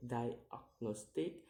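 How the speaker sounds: "diagnostic" slowly split into syllables; slow, separated syllables on "diagnostic". Shown as a demonstration. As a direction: sound out "diagnostic" piece by piece. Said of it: "count the word syllable backwards".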